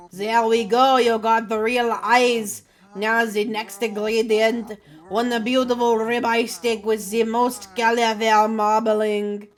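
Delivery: put-on slow French-accented chef. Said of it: "Aggressively French".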